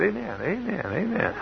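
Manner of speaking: high, soft, sing-song amens; eager amens. Retold or imitated. Boy's reply to fruit offer.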